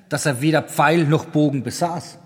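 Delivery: ordinary delivery, nothing marked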